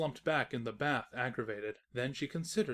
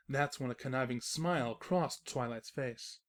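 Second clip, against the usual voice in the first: ordinary delivery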